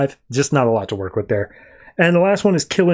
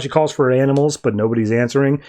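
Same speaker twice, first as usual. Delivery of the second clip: normal talking voice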